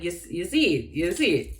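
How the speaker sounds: "you see it" in Jamaican Patois with falling tune. Shown as a demonstration. Vlogger trying to explain the phrase, falling patois accent. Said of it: "I can't explain it".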